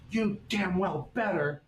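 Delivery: slow, high, biting threat; hammed-up slow high warning; furious teacher. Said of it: "And she's like".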